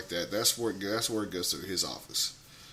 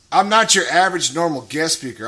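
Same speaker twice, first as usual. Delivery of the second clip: ordinary delivery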